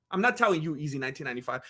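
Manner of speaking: usual speaking voice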